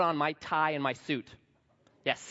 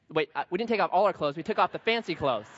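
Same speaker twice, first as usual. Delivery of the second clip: usual speaking voice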